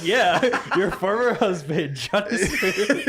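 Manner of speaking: slow, laughing drawn-out name; laughing showman intro. Acted. She presents her former husband, laughing and drawn out. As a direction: savor the name while laughing. Continuing intro of Johnny Smooth.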